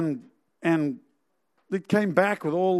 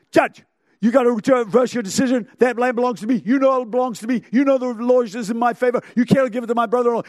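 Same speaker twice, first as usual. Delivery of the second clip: higher, louder demanding plaintiff; insistent pleading; 3 semitones higher, 4 dB louder, faster than usual. Acted. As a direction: badger the judge, pressing every sentence. Acted plaintiff demanding reversal from judge.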